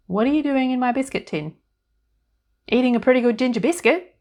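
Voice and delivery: put-on high, loud, chirpy little old woman; fairy godmother voice. A narrator's reading. Tiny woman in the biscuit tin greeting Annie, high and chirpy. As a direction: pipe up brightly from inside the tin.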